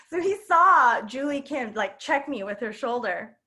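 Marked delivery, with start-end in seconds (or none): none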